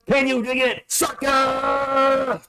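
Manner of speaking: high shouted wrestler catchphrase; Booker T impression. Streamer quoting a wrestler's catchphrase, high shout. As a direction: yell the taunt like a wrestler. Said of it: "that's John Cena ... Booker T did that".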